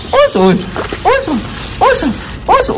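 high squealing exclamation; surprised squeal. Acted. Exclaiming at the creatures.

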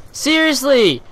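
loud, high, outraged Seriously!; exasperated shout. Real, not acted. Gamer yelling at a camper, shouting in disbelief.

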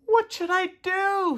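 high-pitched, contained scream; mild scream. A narrator's reading. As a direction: cry the question out very high. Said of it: "screamed Tudley".

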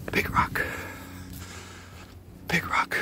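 hushed, voiceless repetition; awed whisper. Real, not acted. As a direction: whisper it to yourself. Hushed awe at big rock.

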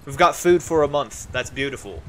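usual speaking voice

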